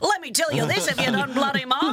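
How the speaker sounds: put-on high-pitched old Aussie club regular; old working club man. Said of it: "my old working club man".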